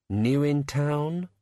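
usual speaking voice

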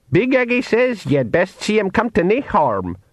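put-on high old Scots pixie.

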